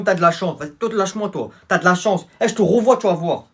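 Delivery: usual speaking voice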